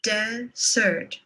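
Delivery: DESert with the stress on "des"; first-syllable stress. Shown as a demonstration. Teacher contrasting look-alike words, first-syllable stress on "desert". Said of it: "Stress is what makes these words recognizable".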